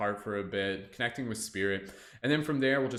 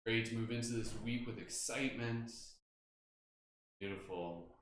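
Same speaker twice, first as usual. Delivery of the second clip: guided meditation; 4 dB quieter than usual. Acted. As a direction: guide them gently into the body. Leads a movement meditation.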